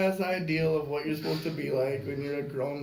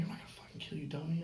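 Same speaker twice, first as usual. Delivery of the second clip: whispering; 2 semitones higher, 9 dB quieter, faster than usual. Retold or imitated. Hushed reply in a hold.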